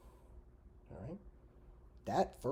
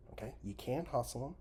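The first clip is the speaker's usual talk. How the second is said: usual speaking voice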